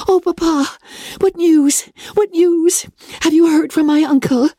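high, eager, breathless questions; eager cry. Acted. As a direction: call out eagerly, pitch up high. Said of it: "eagerly cried out".

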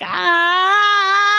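sharp high yelp of a screaming dog; short piercing dog scream; imitated dog scream. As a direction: shriek like a panicked little dog.

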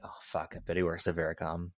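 whispered aside, fast, to himself; a quick whispered oh no; hushed realization. Retold or imitated. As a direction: breathe it out like a sudden guess. Retelling own reaction while watching.

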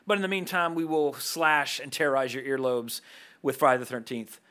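ordinary delivery

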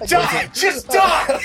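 hammed-up battle rage shout; yelling in anger. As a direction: scream it at the enemy in a rage. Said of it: "he is yelling in anger".

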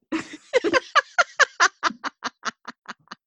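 high, helpless burst of laughter; real prolonged cackling; long laugh. Real, not acted. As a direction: laugh until it trails away. Laughs hard at the story.

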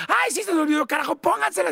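high careless diva quip; imitating Cristina. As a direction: toss it off like a spoiled star. Cristina voiced in the story.

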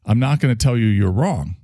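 normal talking voice

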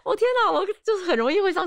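laugh, then a high disbelieving "my god". Real, not acted. Mocking her own naive past self, laughing.

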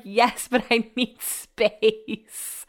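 slow, stretched words to make the point; slow drawn-out reply. Retold or imitated. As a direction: draw it out deliberately. Replying to cuddling boyfriend.

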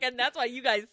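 teasing impression of a smug listener; laughing mockery; mocking voice. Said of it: "And Miles is like".

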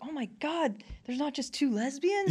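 high-pitched retold amazement; recounting a happy shock; amazed realisation. Retelling amazed arrival in New York.

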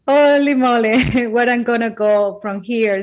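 giggling holy moly; laughing. Retold or imitated. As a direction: laugh in disbelief at the question. Laughing, quoting her amazed self.

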